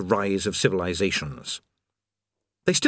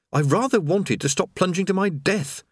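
dry complaint; 8 semitones higher than usual. Acted. Rincewind voice.

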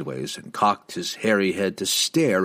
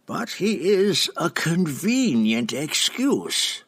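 put-on slow, high, sly old matron; old drow matron; 8 semitones higher, slower than usual. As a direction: purr it like a scheming old witch. Matron Benray voice, narrated fiction.

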